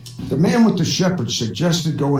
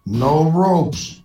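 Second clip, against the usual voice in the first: slow stern; much slower than usual. Acted. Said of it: "The bartender says".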